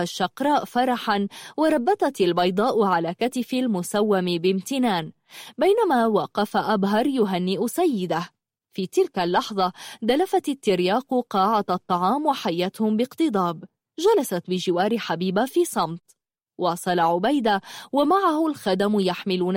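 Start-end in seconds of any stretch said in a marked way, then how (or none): none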